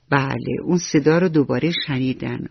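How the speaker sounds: ordinary delivery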